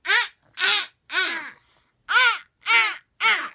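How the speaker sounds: loud gulping ahs, like eating; chomping ahs. Retold or imitated. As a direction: chomp the numbers up. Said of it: "he eats the ones ... he goes".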